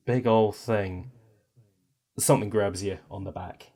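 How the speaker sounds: ordinary talk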